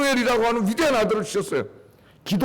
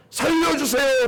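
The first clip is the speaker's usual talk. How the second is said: cry for help; 6 semitones higher, slower than usual. Shown as a demonstration. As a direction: beg God to save you. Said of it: "이렇게".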